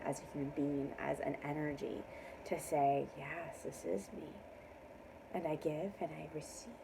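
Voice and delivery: low, hushed, near-whispered mantra; soft affirmation. Real, not acted. Reader giving viewers closing affirmations, hushed. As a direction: murmur it low and very softly, drifting into whisper.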